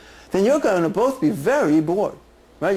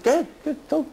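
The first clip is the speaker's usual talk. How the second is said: much higher, teasing shoulder-devil urging; egging-on voice; 9 semitones higher, much faster than usual. Retold or imitated. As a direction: push him on like a voice on his shoulder. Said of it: "feel me on his shoulder".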